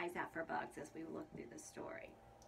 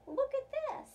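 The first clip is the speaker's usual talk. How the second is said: much higher and louder, thrilled; excited; 9 dB louder than usual. Real, not acted. Shows picture to children.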